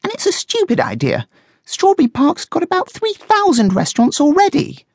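very high, indignant guinea pig; high-pitched, dismissive objection; strong disapproval. A narrator's reading. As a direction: scoff in a piercingly high voice. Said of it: "She strongly disapproved".

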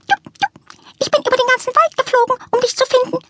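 very high, fast, breathless chirp; chirping bird. A narrator's reading. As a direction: zip through it like a tiny bird. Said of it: "zirpte der Grünling".

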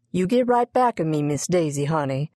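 plain reading voice

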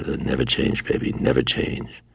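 soft, slow whispered plea; hushed whisper. Retold or imitated. Husband begging his wife to never change, whispered. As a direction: breathe it close to her ear. Lovers' intimate vow retold.

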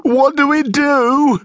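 slow, very high, frightened whine; drawn-out, high-pitched helpless plea; whimpering. A narrator's reading. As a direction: whimper it like a cowardly thief. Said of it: "whimpered Stefan".

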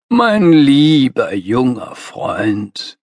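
slow, drawn-out patronizing address; lightly pompous master. A narrator's reading. Master begins lecturing the young idealist, patronizing. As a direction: stretch every word with mentor's condescension.

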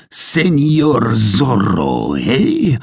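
slow, scornful Señor Zorro, eh? with a high jeering eh; the sergeant sneers the name, his eh leaping up in disgust; bellowing soldier, scorn on Señor Zorro; terrible voice. Acted. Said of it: "Gonzalez cried in a terrible voice".

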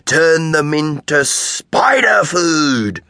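slow, hammed-up witch menace; boy lunging at smaller friend, mock menacing; mock witch threat. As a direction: creep the words out to scare him.

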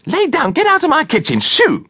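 very high, confused shooing cry; flustered cry. A narrator's reading. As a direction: shoo the dog out in a high-pitched fluster. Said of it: "Missus Mitchell cried confusedly".

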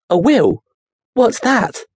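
put-on boy asking the creature what a Will is; puzzled boy. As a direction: ask it completely baffled. Daniel puzzled.

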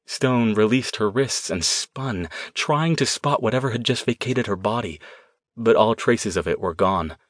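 ordinary delivery

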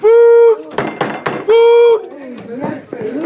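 bellowed shouts, pitched high and loud; yelling for food. Acted. Master bellowing at his servants for food, yelling. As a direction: roar it across the hall.

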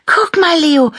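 very high, loud shout to a friend; squealed, urgent excitement; excited call. A narrator's reading. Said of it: "rief Annabella".

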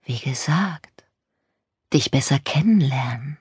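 breathy whisper right into the ear. Acted. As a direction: whisper it close to her ear. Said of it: "Er flüsterte mir ins Ohr".

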